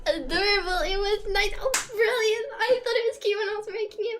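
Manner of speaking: normal talking voice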